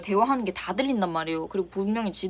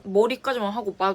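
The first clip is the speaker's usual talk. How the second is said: usual speaking voice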